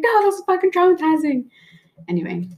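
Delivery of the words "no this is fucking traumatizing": lightly voiced upset child, pitched higher; mock kid protest. Telling her mom about the kiss, a higher mock childish protest. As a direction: protest like a little kid who isn't being taken seriously.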